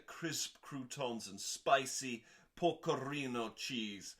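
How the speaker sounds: usual speaking voice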